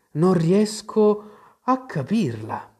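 careful, courteous puzzlement, slow at first; very polite. A narrator's reading. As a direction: say it as politely as you can. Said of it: "con il maggior garbo possibile". (con estremo garbo)

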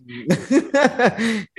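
high, amused laughter; real laugh. Real, not acted. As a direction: burst out laughing at a funny mispronunciation. Amused by broccoli mispronunciation. (หัวเราะขำเสียงสูง)

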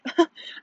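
short, high chuckle; brief laugh at the memory; real laugh. Real, not acted. Telling viewers about water breaking, short amused laugh.

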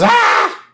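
high, loud cartoon scream; acted shriek of fright; mock scream. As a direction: scream as if ambushed. Bart terrified by Willie in nightmare.

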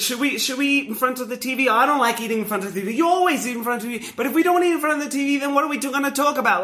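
high, fast overlapping argument; fast family bicker. Retold or imitated. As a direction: bicker rapidly like squabbling relatives.

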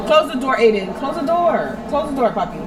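loud, high call across the house. Real, not acted. Mother calls to Aiden to shut the door, loud and high. Calling out to child.

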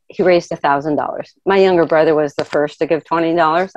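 ordinary delivery

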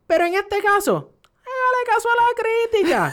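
very high, loud mock squeal; mocking quote. Host retelling a post's sudden turnaround, mocking. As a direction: squeal the quote in exaggerated ridicule. Mocks retold post's about-face.

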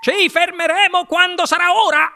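high, loud call from ahead; Wonka grida. A narrator's reading. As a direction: shout it back over the distance. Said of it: "gridò il signor Wonka già lontano". (grido acuto e forte di chi è già lontano)

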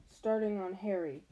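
ordinary delivery, nothing marked

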